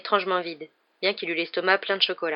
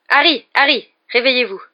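loud, raised calls to wake someone; urgent calling; 6 semitones higher, 10 dB louder than usual. Acted. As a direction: call out to rouse him. Lupin tries to rouse Harry. (appel pressant pour réveiller)

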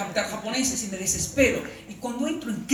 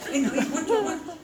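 higher, louder calling out to a stranger; grandpa's call; 8 semitones higher, 3 dB louder than usual. Retold or imitated. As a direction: beckon a stranger, rising. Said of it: "No, importante llamarlo".

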